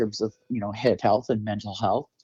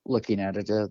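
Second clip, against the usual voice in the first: usual speaking voice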